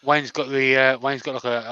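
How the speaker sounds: usual speaking voice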